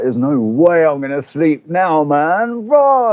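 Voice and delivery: rising to a high excited yelp on raw; wired, can't-sleep excitement building to a shout; buzzing with energy. Retold or imitated. Retelling to a friend how wired he felt, building to a yelp. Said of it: "I was like".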